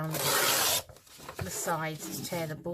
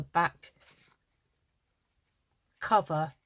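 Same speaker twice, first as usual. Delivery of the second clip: yawning; 5 dB louder, slower than usual. Real, not acted. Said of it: "excuse me for yawning".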